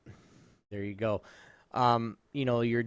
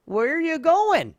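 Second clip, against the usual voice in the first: high, loud call after men driving off, fast; calling after them; 11 semitones higher, 8 dB louder than usual. Retold or imitated. Driver shouting after men driving away, high loud mock protest.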